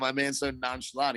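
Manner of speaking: ordinary talk, nothing marked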